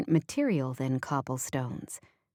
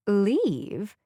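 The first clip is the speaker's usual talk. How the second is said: puzzled, questioning leave?; deeply confused; 4 semitones higher than usual. Acted. Boss hearing about the leave, baffled question. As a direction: ask leave? as if the word makes no sense. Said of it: "She sounds deeply confused".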